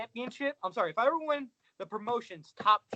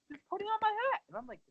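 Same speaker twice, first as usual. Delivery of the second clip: normal talking voice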